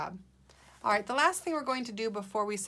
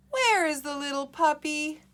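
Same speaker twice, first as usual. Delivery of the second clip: high, loud, exasperated asking; frustrated, tired question; 8 semitones higher, 7 dB louder than usual. Acted. Teacher models Rosie after a long search, frustrated and tired. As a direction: push the question out, fed up. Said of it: "sound a little frustrated and tired".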